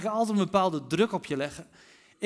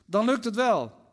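raised, louder encouraging call; 5 semitones higher, 5 dB louder than usual. A narrator's reading. Jesus assures the fishermen from the shore, calling out. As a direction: call it across to the boat. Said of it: "riep Jezus".

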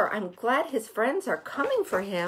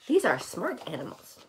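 usual speaking voice

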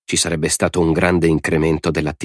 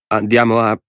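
"Andiamo a" higher, left hanging before a pause; "Andiamo a" pitched up, unfinished; broken-off order; 4 semitones higher than usual. A narrator's reading. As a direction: begin the order on "Andiamo a" and stop short. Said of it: "fece una pausa e un respiro profondo".

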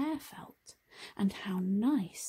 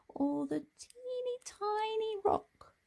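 much higher, small-voiced teeny tiny; 12 semitones higher than usual. A narrator's reading. Narrator shrinks the voice for the teeny tiny rock. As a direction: squeak it out to make the rock sound tiny.

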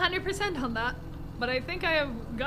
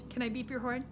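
ordinary talk

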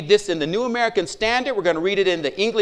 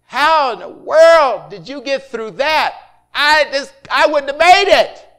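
slow, loud, higher astonished cry; amazed disbelief; 4 semitones higher, 5 dB louder, slower than usual. Retold or imitated. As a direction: marvel out loud at what he survived. Retold amazed reaction.

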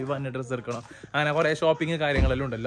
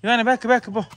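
calling out; 8 semitones higher, 5 dB louder than usual. Real, not acted. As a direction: call Ivan firmly to the back seat. Calling child to back seat. (ഉറക്കെ വിളിക്കുന്നു)

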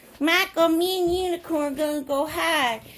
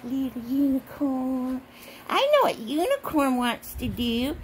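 usual speaking voice

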